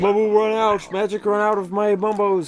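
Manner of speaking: high, loud mock-broken-English cry; game character voice. Player parodies Mumbo's out-of-magic warning, high loud character voice. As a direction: call it out high like a cartoon shaman.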